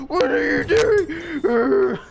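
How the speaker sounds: high, slow shrieking scream at yourself; mock scream. To his reflection in the mirror, a hammed-up slow scream. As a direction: scream at your own reflection in mock horror. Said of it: "Oh, she's screaming".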